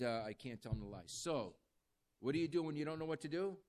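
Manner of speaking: ordinary delivery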